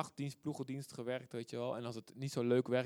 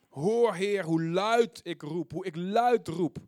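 drawn-out, raised calling to God; loud psalm cry; 4 semitones higher, 9 dB louder, slower than usual. Acted. Preacher crying to the Lord as psalmist, loud calling. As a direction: call out slowly at full voice.

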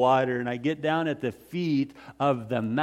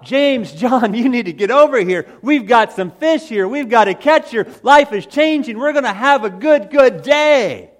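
high, loud call across the water; excited shout; 9 semitones higher, 10 dB louder than usual. Acted. Fisherman calling his partners over, excitedly shouting. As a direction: yell for the partners to come help.

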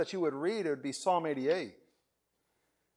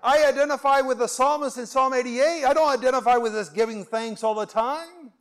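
higher, louder, pleading objection; protesting believer; 8 semitones higher, 9 dB louder than usual. Retold or imitated. Preacher voicing a doubting listener, high and loud protest. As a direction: push back on the call to give thanks. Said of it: "and you're saying".

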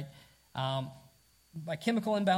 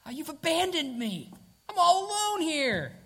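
high, wailing self-pity, rising louder at the end; melodramatic whine; 11 semitones higher, 4 dB louder than usual. Retold or imitated. Preacher mimics an anxious believer, high melodramatic wail. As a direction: ham up the abandonment like a martyr. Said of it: "Like you're in a wilderness or something".

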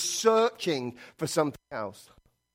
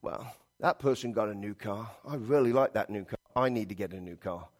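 much lower and softer, muttering to yourself; a low, wanting murmur about the neighbour's car; envious inner voice; 7 semitones lower, 8 dB quieter than usual. Retold or imitated. Preacher voicing a churchgoer coveting a new car, low and soft. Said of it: "'cause we go".

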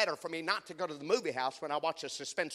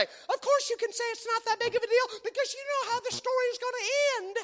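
much higher, indignant wife voice; husband mimicking wife's retort; imitating wife; 5 dB louder than usual. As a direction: scold him as the wife. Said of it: "And she'll say".

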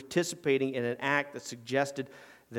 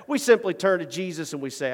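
ordinary talk

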